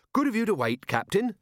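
a hint of an upbeat officer's voice; lightly voiced genial officer. A narrator's reading. Carrot greets the waiting ship's captain, genial.

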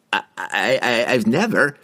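flustered wizard tripping on words; stammering. A narrator's reading. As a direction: stammer and fail to finish. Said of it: "Harkle stammered".

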